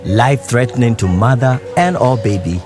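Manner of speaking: ordinary talk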